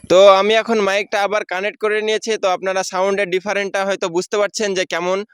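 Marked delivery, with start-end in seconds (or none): none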